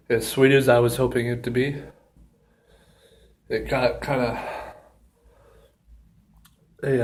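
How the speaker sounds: a little out of breath. Real, not acted. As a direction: speak short of breath. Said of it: "kind of out of breath a little bit".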